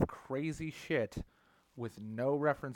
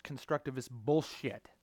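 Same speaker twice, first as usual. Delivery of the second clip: ordinary delivery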